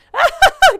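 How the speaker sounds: a sudden burst of high, loud laughter. Real, not acted. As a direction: let a big laugh explode out. Something funny happened on stream.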